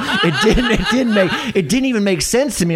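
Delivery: high, loud, laughing through the friend's words; laughing quote. Retold or imitated. Retelling his friend's baffled verdict, laughing loudly. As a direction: mimic her confusion while cracking up. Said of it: "And she's like".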